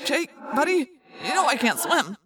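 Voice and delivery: halting, pained appeal; weak pleading. Acted. Wounded friend telling leader he can't swim, weak plea.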